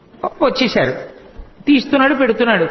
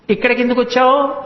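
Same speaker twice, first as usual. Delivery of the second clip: gopika questioning; 3 semitones higher than usual. Acted. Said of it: "అంది".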